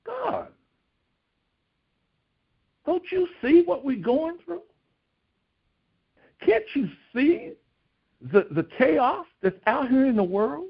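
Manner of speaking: high, strained cry of a frightened believer; desperate plea to God. Retold or imitated. God's people crying out to God about the chaos, high desperate plea. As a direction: plead upward in distress, voice pitched high. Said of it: "what are the people of God saying".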